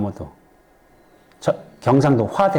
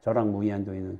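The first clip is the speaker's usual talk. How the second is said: low, soft monotone verse; quiet, level chant of a memorised line; rote recitation; 5 semitones lower, 9 dB quieter than usual. Shown as a demonstration. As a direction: recite from memory on one note. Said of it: "그냥 외울 줄만 알았지".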